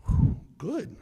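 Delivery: high, loud burst of relief; big relieved whew. Retold or imitated. Agent blows out relief at the suspect's answer. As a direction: exhale the relief sharply.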